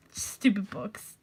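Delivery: ordinary talk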